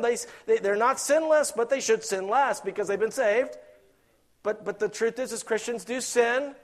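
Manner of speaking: loud, high preacher's call; preaching at full force. Acted. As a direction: drive it home to the congregation.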